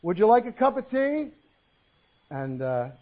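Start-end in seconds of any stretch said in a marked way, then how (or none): 0.0-1.3 higher, questioning call across the house; yelled from another room. Acted. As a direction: call out to someone out of sight. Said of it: "he yelled out from the kitchen".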